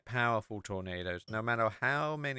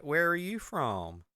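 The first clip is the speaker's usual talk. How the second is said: lightly voiced country local, higher and slow; a hint of a curious roadside local; American gas station owner; 5 semitones higher, slower than usual. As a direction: draw the question out slowly, pitched up. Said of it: "it's a husband and wife".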